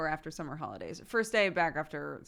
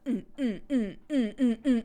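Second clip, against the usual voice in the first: rapid mock grunts, higher pitched; imitated kid fussing; 4 semitones higher, 5 dB louder than usual. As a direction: fuss in short repeated "mm" bursts. Imitating hyper kids' fussing.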